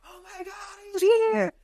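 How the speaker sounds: high, soft awestruck fan voice; awed fans. Retold or imitated. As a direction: mimic the stunned fans. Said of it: "everybody just roaring at you like".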